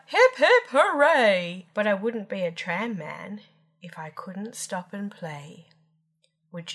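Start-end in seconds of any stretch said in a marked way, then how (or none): 0.1-1.7 high, loud cheer; joyful shout. A narrator's reading. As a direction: shout a big hip-hip-hooray. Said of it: "I'd shout".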